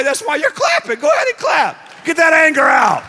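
high, loud, urging the crowd on; shouting encouragement at the congregation; rousing shout. Real, not acted. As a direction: fire them up to clap and let it out. Preacher urging crowd to clap.

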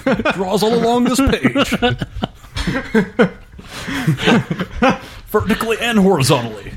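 high, grand theatrical voice; hammy stage actor. Pretend. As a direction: ham it up with a laugh. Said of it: "didn't overact as much as Daniel Day".

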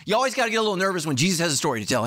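ordinary delivery

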